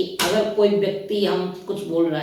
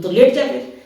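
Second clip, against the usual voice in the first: loud, higher taunting comeback; retorting louder in a retold fight; mock shouted retort; 7 semitones higher, 8 dB louder than usual. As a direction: fire back the line, raised and mocking.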